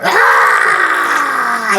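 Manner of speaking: high, loud squeaky creature shriek; rat creature squeal. Acted. Game master voicing the rats, shrill crowd squeal.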